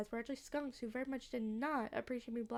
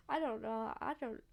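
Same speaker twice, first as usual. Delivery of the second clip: usual speaking voice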